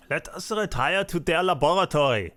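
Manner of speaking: a mild put-on German mad scientist; terrible German accent. Mad scientist inviting you to his lab, bad German accent. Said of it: "in my terrible German accent".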